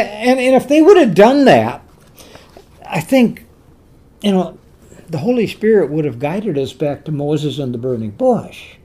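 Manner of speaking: ordinary talk, nothing marked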